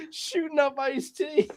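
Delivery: high, slow, through helpless laughter; laughing. Real, not acted. Repeating a friend's joke, laughing hard. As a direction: repeat it while cracking up.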